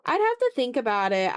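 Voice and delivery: ordinary talk, nothing marked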